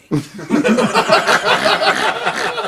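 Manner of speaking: high, loud laughing fit; big laugh. Real, not acted. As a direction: crack up loudly. Laughing at the punchline.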